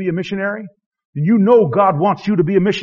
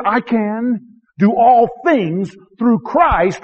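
slow scripture quote; 4 semitones higher, much slower than usual. Acted. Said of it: "He says".

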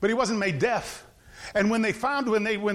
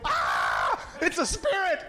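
hammed-up frightened yell, voice pitched oddly; scared cry; 7 semitones higher, slower than usual. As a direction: cry out like terrified fishermen. Disciples see Jesus on water.

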